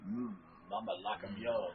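low, purring cougar voice; sultry mama voice. Retold or imitated. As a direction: purr it low and suggestive. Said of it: "she says".

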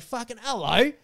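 indignant call. Retold or imitated. Dog walker calling to the owner on the bench, indignant. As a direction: call out in exasperation to get his attention.

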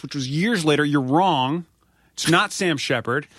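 mock yelling at a drunk star; retold yelling. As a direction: insist hotly that he's wrong. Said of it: "you're yelling at [name]".

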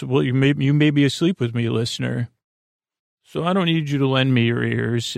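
normal talking voice

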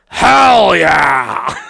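low, loud, drawn-out party cheer; mock enthusiastic yell. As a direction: cheer it like you love the idea. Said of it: "And you go".

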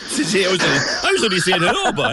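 quick, laughing Cork banter. Real, not acted. Radio host teasing caller with Cork slang, laughing.

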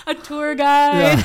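high, loud and stretched out, with laughter; giggly high-pitched exclamation; loud amused laughing. Real, not acted. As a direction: call out the absurd idea, laughing. Joking at her naive expectations.

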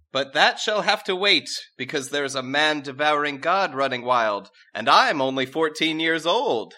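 high, loud mock-regal princess voice; haughty princess. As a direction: play the rebellious princess grandly. Princess delays marriage.

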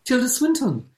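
put-on airy, theatrical self-introduction in an English accent; Tilda Swinton. Comic answering "who are you?" as an otherworldly film star, grandly. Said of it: "someone will say to her".

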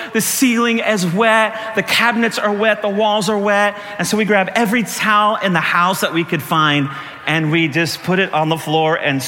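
ordinary delivery

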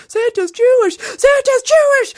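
put-on building high childlike shout. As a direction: escalate like an overexcited kid, very high. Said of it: "louder and louder and louder".

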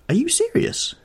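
Basil startled that Henry means it; incredulous question. Acted. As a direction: ask it in open disbelief.

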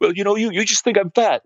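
high mock-tearful whine; put-on weepy woman's voice; imitated crying. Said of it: "Now she's crying her eyes out".

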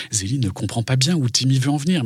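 ordinary delivery, nothing marked

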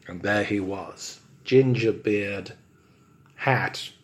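ordinary talk, nothing marked

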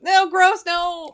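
loud, high disgusted cry. Real, not acted. Disgusted outcry.

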